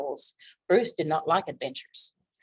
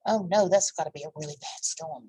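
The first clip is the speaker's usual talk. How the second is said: ordinary delivery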